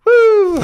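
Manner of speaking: very high, loud cheering "woo"; loud whoop. Retold or imitated. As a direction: let out a piercing mock celebration whoop. Acting out cheering Hitler's winning.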